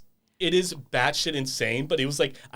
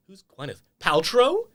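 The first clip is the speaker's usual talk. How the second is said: mock puzzled question; 6 semitones higher, 4 dB louder than usual. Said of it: "And Bethany's like".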